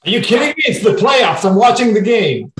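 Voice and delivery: loud, high-pitched indignant protest; mock outrage. Husband glued to the playoffs protesting to his wife, mock outrage. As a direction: play the indignant husband, voice shooting up. Listener plays husband refusing the request.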